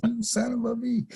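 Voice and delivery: high, laughing through the insult; mock outrage broken by laughter. Angry retort at laughing partner.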